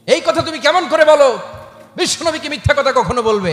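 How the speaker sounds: a burning, indignant rebuke; furious father. Acted. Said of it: "রেগে মেগে আগুন". (মেয়েকে বকা দেওয়া রাগী বাবার গর্জন)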